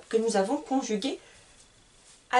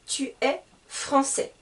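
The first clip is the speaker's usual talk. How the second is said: normal talking voice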